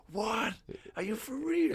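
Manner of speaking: very high, stunned disbelief; acted-out gobsmacked reaction, high-pitched; retold astonishment. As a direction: squeak it out in amazed disbelief. Retelling his stunned reaction to revelation.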